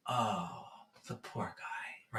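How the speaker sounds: hushed, low, slow sympathy; soft pity. Retold or imitated. Driver feeling for the man who yelled, soft pity. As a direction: sigh it out slowly, almost whispered.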